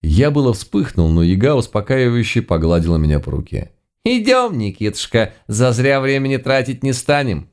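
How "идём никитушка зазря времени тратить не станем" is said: high-pitched granny voice; soothing old granny. A narrator's reading. Yaga calming her indignant lodger, coaxing. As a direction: coax him along in a high old-woman voice.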